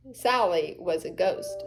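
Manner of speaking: ordinary delivery, nothing marked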